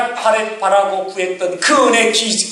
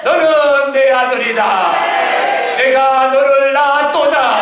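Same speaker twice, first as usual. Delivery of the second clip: slow, raised proclaiming voice of God; solemn divine pronouncement, drawn out; 4 semitones higher, slower than usual. Acted. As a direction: declare it slowly as God speaking. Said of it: "하나님이 말씀하십니다".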